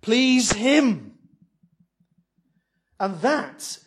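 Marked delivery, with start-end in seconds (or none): 0.0-1.2 loud, slow, pleading urge; people exhorting the priests. Retold or imitated. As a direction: plead with the priests to please God, loud and drawn out. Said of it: "they're saying".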